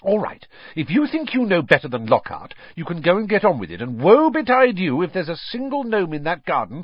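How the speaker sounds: high, heated motherly threat; cross warning. A narrator's reading. Mother sending sons out to do the chore, scolding crossly. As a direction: lay down the law, building in force.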